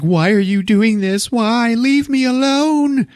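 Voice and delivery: high-pitched mock distraught wail; desperate plea. Victim confronting killer in retold story.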